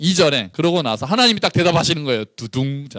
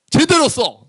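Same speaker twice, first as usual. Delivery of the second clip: much higher, louder order; commanding shout; 11 semitones higher, 4 dB louder, slower than usual. Acted. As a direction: bark it like a stern command. God ordering clear writing. (크게 외치는 명령)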